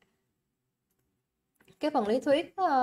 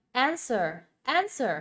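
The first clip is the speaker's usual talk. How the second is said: "answer" stressed on AN, twice; first-syllable stress; slower than usual. Shown as a demonstration. Teacher repeats the word for students, first-syllable stress. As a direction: hit AN in "answer", let -swer fall. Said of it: "âm một ha".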